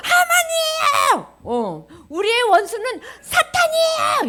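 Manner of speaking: very high, loud, drawn-out cry; accusing shout. Acted. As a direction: shout the enemy's name in a wail. Esther's accusation, acted for the class. (높고 길게 울부짖는 고발)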